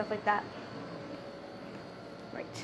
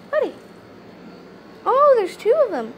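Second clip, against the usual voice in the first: higher and louder, delighted; excited squeal; 10 semitones higher, 6 dB louder than usual. Real, not acted. Spotting two sugar gliders, a thrilled high squeal. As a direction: gush over the two pets.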